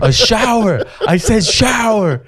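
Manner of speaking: high, slow mock-angry insistence; pissed. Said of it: "I was pissed".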